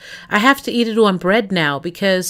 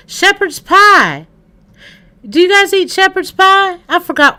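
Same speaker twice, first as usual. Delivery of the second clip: excited; 10 semitones higher, 6 dB louder than usual. Real, not acted. Excited on finding a recipe.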